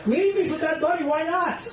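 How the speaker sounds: high falsetto fake cheer; mock eager. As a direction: chirp it out like an overly supportive boyfriend. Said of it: "I'm like".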